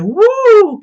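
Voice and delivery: high, loud "woo"; mock-gleeful high whoop; imitated villain whoop.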